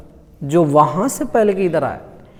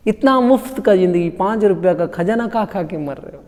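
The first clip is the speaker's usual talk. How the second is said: ordinary delivery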